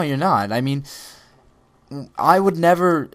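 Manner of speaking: normal talking voice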